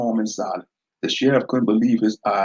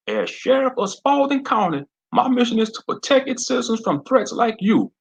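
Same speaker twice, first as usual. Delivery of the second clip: much higher, loud southern lawman; menacing sheriff; 13 semitones higher, 3 dB louder than usual. Acted. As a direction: lord it over them in his backyard. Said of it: "Sheriff McClure mentioned".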